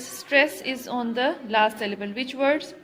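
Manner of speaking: ordinary talk, nothing marked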